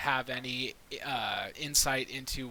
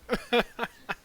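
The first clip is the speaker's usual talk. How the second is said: high delighted laugh; 9 semitones higher, 4 dB louder than usual. Real, not acted. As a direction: laugh out loud. Laughing at orc voice.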